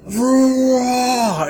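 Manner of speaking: high, loud hammed-up roar; mock monster roar. Imagining the huge guy bursting out of the bathroom, mock roar. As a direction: roar like a raging giant bursting through a door. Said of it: "jumping out and being like".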